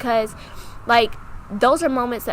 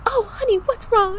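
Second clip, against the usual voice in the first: high, cooing concern; higher-pitched motherly sympathy; imitated soothing stepmom; 13 semitones higher than usual. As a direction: coax like a worried stepmom. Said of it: "talking to her so soothing".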